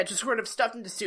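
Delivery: ordinary talk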